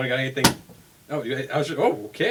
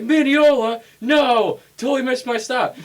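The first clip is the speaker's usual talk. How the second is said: much higher and louder, a mock panicked cry; dramatic outburst; 11 semitones higher, 9 dB louder, slower than usual. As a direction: play the passenger who just missed his stop, wailing. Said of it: "I hope he sounded this dramatic".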